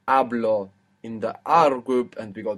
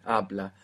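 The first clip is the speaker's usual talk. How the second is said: "Habla" stressed on its first syllable, lower voice; 4 semitones lower than usual. Shown as a demonstration. Teacher showing student where the accent falls, "Habla" stressed up front. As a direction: put the accent at the beginning of "Habla". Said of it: "The accent there at the beginning".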